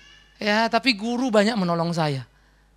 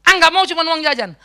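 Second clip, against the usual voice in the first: put-on higher, louder bratty kid; a son haggling with his mother, higher and louder; whiny refusal; 8 semitones higher, 7 dB louder than usual. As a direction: push back for more than pocket money. Boy refusing mother's bribe.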